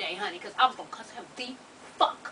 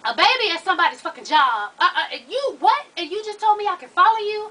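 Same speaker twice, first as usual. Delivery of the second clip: loud, high indignant rant; rising outrage at the hospital staff; outraged; 6 semitones higher, 7 dB louder than usual. Real, not acted.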